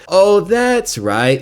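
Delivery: high, slow, drawn-out "oh, that's right"; mock realization. Real, not acted. Commentator hinting at steroids to viewers, slow mock realization. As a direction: play it like a fake lightbulb moment.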